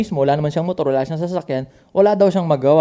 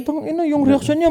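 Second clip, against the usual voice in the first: normal talking voice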